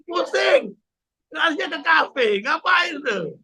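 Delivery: high, shouted mocking question; a sarcastic high yell at those who drink.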